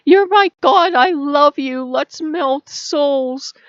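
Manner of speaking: high, loud, adoring dog voice; slow down on melt souls, overflowing with doggy love; a dog smothering its owner with adoration; gushing dog. Retold or imitated. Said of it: "It's not like a dog where".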